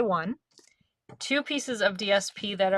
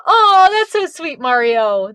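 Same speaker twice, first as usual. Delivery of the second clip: high, touched aww; sympathetic aww; 8 semitones higher, 12 dB louder, slower than usual. Real, not acted. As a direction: melt at the sweet comment. Reacting to a viewer's comment.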